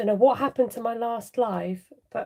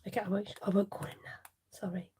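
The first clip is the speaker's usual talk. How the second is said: whisper; 9 dB quieter, faster than usual. Real, not acted. Apologising softly for slip.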